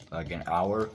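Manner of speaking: ordinary talk, nothing marked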